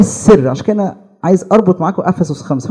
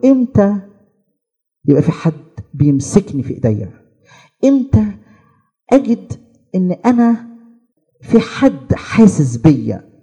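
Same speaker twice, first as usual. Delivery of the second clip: acted-out wistful inner voice, starting high; imitated longing; 4 semitones higher than usual. Single person seeing couples asks inwardly, mock longing. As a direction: voice a lonely person's yearning, drawing out the last words.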